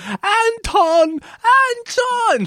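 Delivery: high-pitched mock scream of a name; imitated screaming. Imitating a wife screaming after her arrested husband, mock scream. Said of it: "in the background screaming".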